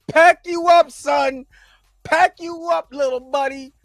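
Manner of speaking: mock the other guy's taunt up high. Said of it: "he said".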